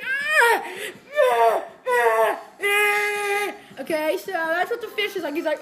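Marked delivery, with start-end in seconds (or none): 0.0-3.5 short loud yelps, fading; repeated frustrated yelps. Real, not acted. Gamer yelps repeatedly at the game. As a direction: yelp again and again as it goes wrong. Gamer screams during play.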